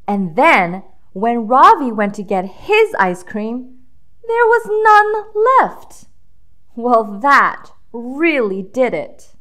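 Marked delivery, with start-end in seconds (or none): none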